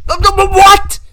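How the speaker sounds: high, loud incredulous what; stunned outburst; shocked what. Real, not acted. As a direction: snap back in disbelief. Shocked reaction to absurd quote.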